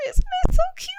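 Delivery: very high, loud squeal of delight; squealing gush. Real, not acted. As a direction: squeal like it's the cutest thing ever. Gushing over cute print.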